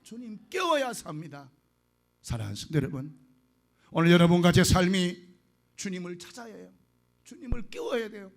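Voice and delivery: earnest preaching to the flock. Acted. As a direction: exhort the congregation with fervour. Preaches to the congregation. (회중에게 열정적으로 설교)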